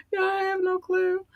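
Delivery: tiny high-pitched voice; overcome squeak. Real, not acted. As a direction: squeak it out, melting with emotion. Repeats it, overcome, after laughing.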